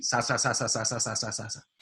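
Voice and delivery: a rhythm of "sa" syllables; sa syllable demo. Shown as a demonstration. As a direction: say "sa" repeatedly to stand in for speech rhythm. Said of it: "se oye algo más o menos así".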